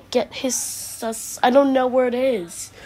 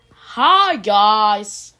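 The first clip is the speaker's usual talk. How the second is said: called-out greeting; 7 dB louder than usual. Acted. Character greeting the whole group, called out.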